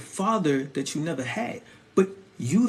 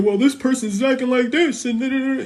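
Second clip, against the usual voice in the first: louder, higher whiny complaint; lightly voiced tattletale; mocking complainer; 5 semitones higher, 5 dB louder than usual. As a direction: whine it like a petty griper. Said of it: "everybody be over here".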